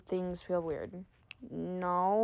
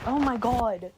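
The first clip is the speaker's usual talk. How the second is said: ordinary delivery, nothing marked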